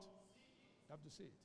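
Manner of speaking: hushed whispered point. Real, not acted. Pastor whispering the key point to the church. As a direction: drop to a whisper to drive it home.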